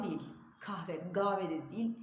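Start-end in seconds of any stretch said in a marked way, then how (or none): 0.6-1.0 "kahve" with a clear h, "kah-ve"; the right way, "kahve" with h; correct pronunciation. Shown as a demonstration. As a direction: sound the h in "kahve". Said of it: "yuttuğumuz harfler".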